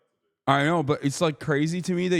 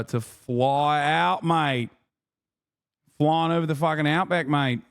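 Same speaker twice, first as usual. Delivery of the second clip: put-on Australian accent; slower than usual. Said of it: "Please work on that accent".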